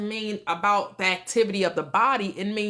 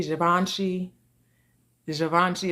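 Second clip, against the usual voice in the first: ordinary delivery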